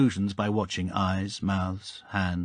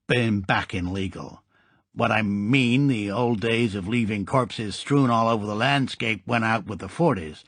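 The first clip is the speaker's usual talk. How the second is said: gangster voice. Acted. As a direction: play the grinning old Chicago gangster. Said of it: "teeth began to operate like a ventriloquist's dummy".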